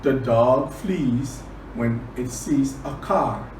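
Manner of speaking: plain reading voice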